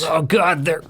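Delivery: high, fast flustered voice; panicky boss voice. Acted. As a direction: blurt it in nervous panic. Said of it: "Bonham retorts".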